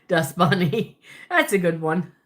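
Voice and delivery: ordinary delivery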